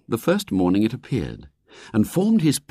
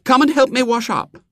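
put-on high, imperious girl's voice; a little girl giving orders; bossy little girl; 5 dB louder than usual. A narrator's reading.

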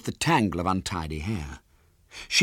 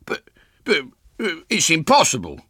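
higher, halting astonishment; stammering disbelief; 9 semitones higher, 5 dB louder than usual. A narrator's reading. Father refusing to believe it's his daughter, stammering. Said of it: "he said in amazement".

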